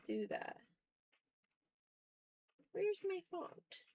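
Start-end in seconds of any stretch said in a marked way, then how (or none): none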